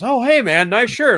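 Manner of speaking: loud, high imitated compliment; mock friendly call. Acts stranger complimenting his shirt.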